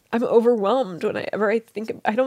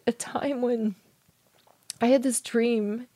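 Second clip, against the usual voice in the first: ordinary talk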